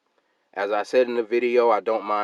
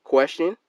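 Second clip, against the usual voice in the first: "question" said higher and stressed; all-caps emphasis; 8 semitones higher, 4 dB louder than usual. Shown as a demonstration. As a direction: lift and hit "question" like capitals. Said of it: "and I capitalized that".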